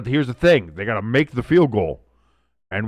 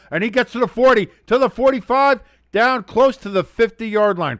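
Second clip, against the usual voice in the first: building live call, higher as the play gains; excited play-by-play; 5 semitones higher, 5 dB louder than usual. Real, not acted. Streamer calling the Packers' last drive, excited play-by-play. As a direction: call the catch with rising urgency.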